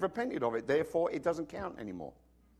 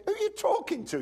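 high, indignant mock father; stern dad. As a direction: rebuke the cheeky kid, hammed up. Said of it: "Dad said".

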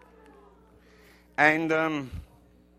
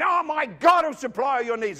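high, loud mock-believer's declaration; confident declaration; 8 semitones higher, 6 dB louder than usual. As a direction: proclaim it like an eager believer. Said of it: "and you're saying".

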